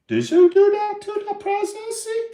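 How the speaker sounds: very high, put-on falsetto question; imitation kid in piercingly high pitch; imitating a kid. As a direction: squeak it out as a mock confrontation. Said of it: "Look him straight in the eyes like this".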